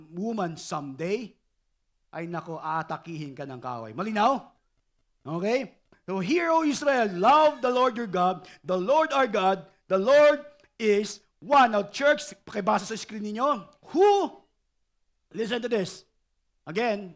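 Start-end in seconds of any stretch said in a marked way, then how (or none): 6.2-11.8 reciting scripture. Acted. Reciting Shema.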